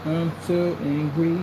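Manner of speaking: stretched-out, mocking "so angry"; imitating anger, very slowly. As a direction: drag out each word as a tease. Slow repeat mocking the angry man.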